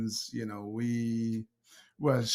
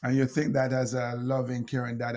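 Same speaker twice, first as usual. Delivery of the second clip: normal talking voice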